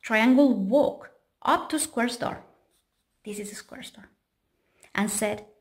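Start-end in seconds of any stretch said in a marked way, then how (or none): 3.2-4.1 whispered aside to the listener; hushed storyteller aside. A narrator's reading. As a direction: lean in and whisper it.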